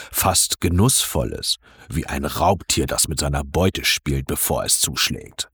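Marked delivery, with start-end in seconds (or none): none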